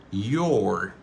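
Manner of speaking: "Your" with a long, full OR vowel; careful, unreduced "Your"; full form. Shown as a demonstration. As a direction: draw out "Your" as yor. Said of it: "Réduction de your".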